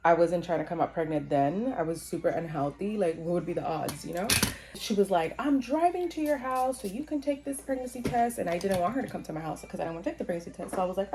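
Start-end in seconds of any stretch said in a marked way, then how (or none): 5.4-8.4 higher-pitched pushy sister, lightly imitated; retold sister announcing she is coming over, pitched up; insistent sister. Sister to her about the pregnancy test, insistent.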